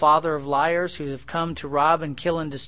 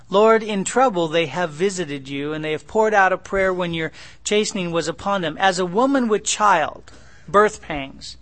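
scripture reading; 2 semitones higher, 5 dB louder than usual. Acted. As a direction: proclaim the verse to the congregation.

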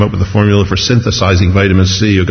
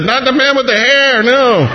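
much higher, lightly voiced little girl refusing; a small child saying no, pitched way up. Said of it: "she said".

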